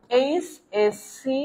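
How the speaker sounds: এইচএসসি stretched out very slowly, letter by letter; very slow spelled-out এইচএসসি; slow pronunciation demo. Shown as a demonstration. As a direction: drag out এইচএসসি so the class hears each letter. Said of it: "খেয়াল করেন যখনই বলছে এইচএসসি".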